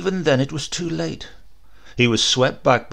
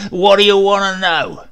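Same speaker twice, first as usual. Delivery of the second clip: loud, high booming brute; a big rough man barking a question; loud bullying stranger; bull of a man; 7 semitones higher, 10 dB louder than usual. Acted.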